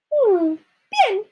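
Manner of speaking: high musing hum, then agreement; fairy godmother. A narrator's reading. Fairy godmother considering the ragged dress, high musing voice. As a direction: ponder aloud in a high voice. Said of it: "dijo su hada madrina".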